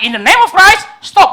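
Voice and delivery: high, very loud insistent cry; imitated shout. Monk shouting because nobody in the stadium hears, imitated high yell. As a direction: yell it at a crowd that will not listen. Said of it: "dia teriak lagi".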